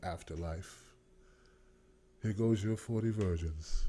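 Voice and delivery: deep, slow, solemn otherworldly voice; glowing afterlife figure. Retold or imitated. Streamer mocking his glowing look with a deep angel voice. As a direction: greet them like an angel at heaven's gate. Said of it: "I was glowing like".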